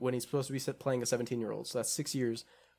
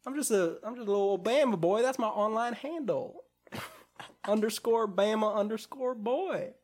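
put-on thick Southern accent; 6 semitones higher than usual. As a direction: play it as a goofy Bama boy, exaggerated Southern. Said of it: "very thick Southern accent".

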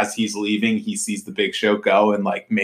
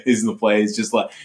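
usual speaking voice

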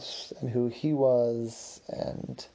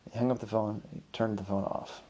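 ordinary delivery, nothing marked

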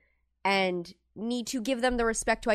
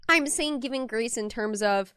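normal talking voice

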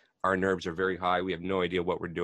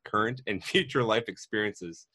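louder, lively scripted welcome; podcast intro read; 3 semitones higher, 6 dB louder than usual. Acted. Host welcomes listeners to the show, scripted intro read. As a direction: read out a podcast opening with energy.

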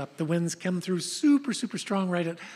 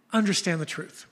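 ordinary talk, nothing marked

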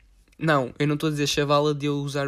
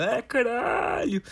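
slow classmate voice; 8 semitones higher, much slower than usual. Retold or imitated. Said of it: "E ele".

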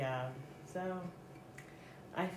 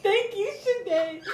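laughing; 9 dB louder, slower than usual. Acted. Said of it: "You can just say".

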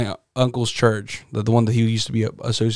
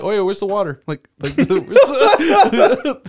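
laughing; 9 semitones higher, 4 dB louder than usual. Retold or imitated. Cousin mimicked, laughing.

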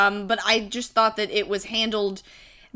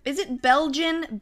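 usual speaking voice

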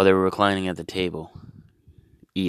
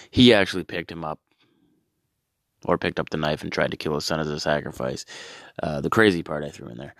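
ordinary talk, nothing marked

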